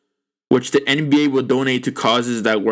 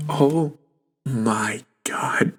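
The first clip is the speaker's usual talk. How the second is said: whispered oh my God; 3 semitones lower, 4 dB quieter, much slower than usual. Real, not acted. Stunned by the investigation numbers.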